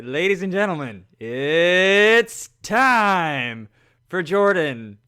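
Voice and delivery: hugely stretched-out announcer call; ring announcer. Pretend. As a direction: draw it out loud, introducing the star. Playing the requested hype man announcer.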